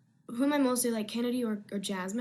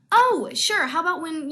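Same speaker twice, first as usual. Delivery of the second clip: Jasmine voice; 6 semitones higher, 8 dB louder than usual. Acted. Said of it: "I'm gonna try to do the Jasmine voice".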